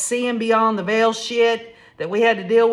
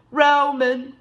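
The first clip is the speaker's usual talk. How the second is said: imitated weeping; 5 semitones higher than usual. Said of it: "emotionally weeping".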